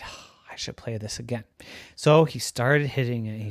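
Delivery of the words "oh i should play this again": quick sneaky whisper. Acted. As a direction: whisper it like a secret. Deer sneaky.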